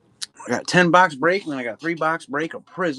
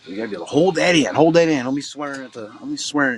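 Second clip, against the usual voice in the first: emphatic lecture; 4 semitones higher, 5 dB louder than usual. Retold or imitated. Lecturing son on self-control.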